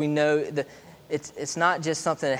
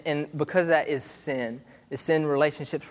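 ordinary talk, nothing marked

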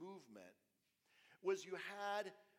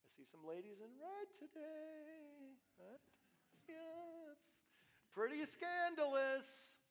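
high, slow mock shock at the red dresses; mock scandalized; 10 semitones higher, slower than usual. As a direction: play the prim church lady, much higher and slow. Mocking strict churchgoers' outrage.

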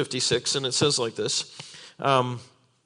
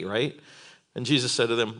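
normal talking voice

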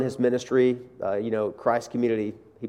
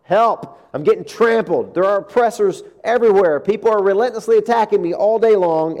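loud, higher panicked plea; desperate cry for help; 8 semitones higher, 6 dB louder than usual. Retold or imitated. Preacher voicing the psalmist crying out for help, loud and panicked. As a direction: cry out as if trampled. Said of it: "He starts out the psalm".

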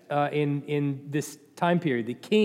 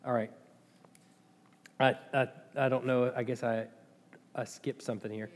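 low, soft, hesitant aside; quiet aside; 6 semitones lower, 9 dB quieter, faster than usual. Real, not acted. Preacher realizes he skipped something, real quiet aside. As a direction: drop your voice, half to yourself. Lost his place, talks aside.

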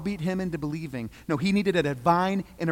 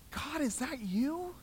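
stunned question; 9 semitones higher, 6 dB quieter, slower than usual. Acted. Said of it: "He said".

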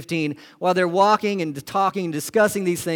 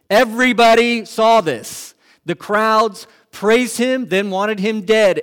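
preaching climax; 6 semitones higher, 8 dB louder, slower than usual. Real, not acted.

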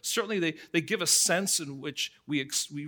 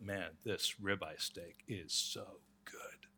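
1 hushed praise; 6 semitones lower, 10 dB quieter, slower than usual. Retold or imitated. Hosts praising the steak.

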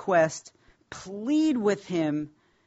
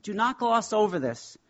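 ordinary talk, nothing marked

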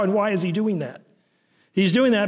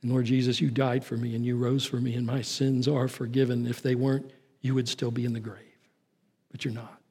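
low, soft prayer to Jesus; hushed prayer; 5 semitones lower, 7 dB quieter than usual. Acted. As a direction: drop into a quiet, intimate prayer. Prays aloud to Jesus within sermon.

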